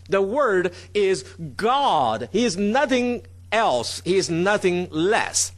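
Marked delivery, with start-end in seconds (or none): 0.1-2.3 slow, with "God" stretched long; stress on God. Shown as a demonstration. Preacher stressing what the slide underlines, weight on "God". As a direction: build to a long, emphatic "God". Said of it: "Everything possible to emphasize that".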